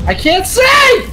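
panicked yell. Real, not acted. Panic in the dark.

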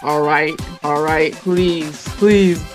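ordinary talk, nothing marked

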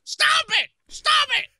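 loud playful yell; shouting. Real, not acted. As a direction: yell it out to stop him. Shouting at teasing partner.

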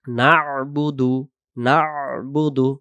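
"না'বুদু" slow, with a throaty ain after না; correct ain. Shown as a demonstration. Teacher modelling correct "না'বুদু", slow with throaty ain. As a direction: draw out না into the ain, then বুদু. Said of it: "সেই শুদ্ধ উচ্চারণ হবে".

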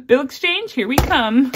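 giddy announcement; excited cheer. Real, not acted. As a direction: cheer it like a rallying cry. Cheerful cry after laughing.

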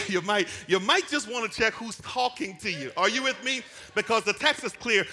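high, loud whooping sermon climb; full-force preaching. Real, not acted. Preacher rousing the congregation, loud and high. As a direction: lift the whole room with it.

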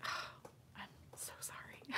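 slow breathy whisper, no voice at all; a mortified whispered sorry; whispered apology. Retold or imitated. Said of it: "and I was like".

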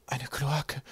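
soft, disgusted groan. A narrator's reading. Kaukab sees the stinking canal below, groaning. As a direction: groan it quietly, a little high. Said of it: "stöhnte Kaukab".

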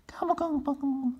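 very high whispered gobbling; whispered gobble. Acted. Second turkey gobbling behind a tree in high whisper.

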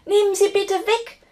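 high, loud, pleading panic; frightened shout. A narrator's reading. Giantess pleading to escape the spider, high frightened shout. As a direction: beg shrilly as if cornered. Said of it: "schrie sie und kletterte ängstlich".

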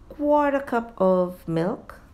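normal talking voice

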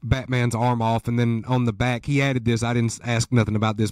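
ordinary delivery

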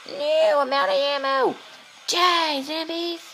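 squealed, high-pitched battle cry; hammed-up high squeal; high panicked yell. Panicked yelling at game zombies.

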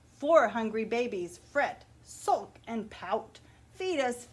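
put-on lively picture-book read-aloud for children; story narration. A narrator's reading. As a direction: read it like story time at the library.

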